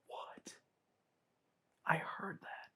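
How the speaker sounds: startled whisper. Real, not acted. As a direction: whisper it, barely breathing. Startled by a sound.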